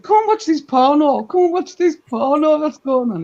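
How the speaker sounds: high, excited mock call-out; retold shout. Bestie calling her husband over to the window, retold shout. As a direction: imitate calling someone in to look. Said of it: "she shouted to her husband".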